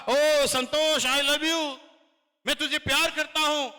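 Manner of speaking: loud, high, slow call; God calling out. Retold or imitated. God calls down to mankind from above, loud and slow. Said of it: "कहना चाह रहा था".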